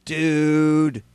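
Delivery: DUDE held long and falling. Shown as a demonstration. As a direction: stretch out "Dude" on a slow fall. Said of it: "one word with a different tone of voice".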